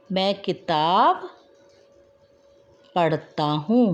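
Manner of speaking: slow, spaced words with long pauses; slow dictation. Shown as a demonstration. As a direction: dictate word by word so children can copy. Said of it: "teacher dictating corrected sentence". (धीरे धीरे शब्द बोलकर लिखवाना)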